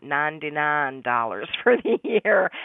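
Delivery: "ninety-nine" as "nahn-dee-nahn", a light teasing Southern drawl with a laugh; mocking the broker's Southern "ninety-nine", laughing; Debbie's Southern accent. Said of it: "she's got that Southern accent".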